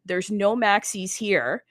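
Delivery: ordinary delivery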